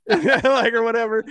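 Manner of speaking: loud, high laugh breaking through. Real, not acted. Laughing at the nurse's line while telling a friend. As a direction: laugh out the words.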